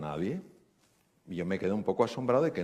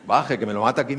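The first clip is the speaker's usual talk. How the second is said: loud, playful "calm down"; doctor loudly urges an upset patient to calm down; urging her to calm down; 2 semitones higher, 9 dB louder than usual. Retold or imitated. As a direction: loudly hush her, half joking. Doctor calming an agitated patient.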